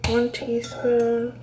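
"One teaspoon" counted out slowly; slow counting. Shown as a demonstration. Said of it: "counting teaspoons slowly".

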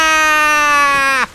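long, high, loud scream; drawn-out yell of alarm; falling scream. Acted. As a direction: scream and hold it. Falls during the game.

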